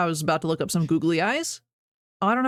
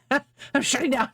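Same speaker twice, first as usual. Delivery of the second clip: slow, laughing mock shutdown; 7 semitones higher, slower than usual. Real, not acted. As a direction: laugh through it, dragging the words. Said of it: "My brain is shutting down".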